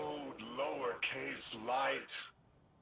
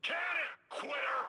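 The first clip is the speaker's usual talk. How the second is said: much higher, louder jeer; scornful shout; 6 dB louder than usual. Acted. Shouting down the quitter, high and loud. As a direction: bark it at him with contempt. Snapping at someone giving up.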